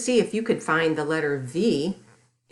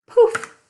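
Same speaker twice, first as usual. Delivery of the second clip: loud high burst on Poof; sudden loud magic poof; 10 dB louder than usual. Acted. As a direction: shout Poof like a magic spell going off. Magic transformation in children's story.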